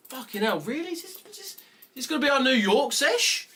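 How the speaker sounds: real disbelief that the market won't move; exasperated disbelief. Real, not acted. Exasperated at a slow session.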